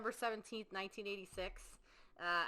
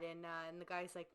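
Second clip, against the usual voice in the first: normal talking voice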